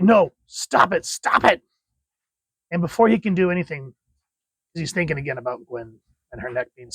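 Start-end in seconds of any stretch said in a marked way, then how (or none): 0.0-1.6 slow, higher, rising "no, stop it"; a mock-anguished Peter begging; pleading Peter. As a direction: beg them to stop, pitch climbing. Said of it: "he's just like".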